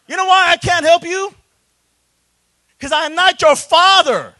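loud and high declaration; forceful retort. Retold or imitated. Pastor answering the young men, loud and high. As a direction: lay it on them with force.

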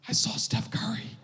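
lightly voiced girl, still dazzled; starstruck child. Daughter repeating to dad she saw Steph Curry, lightly voiced. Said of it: "Twenty minutes later".

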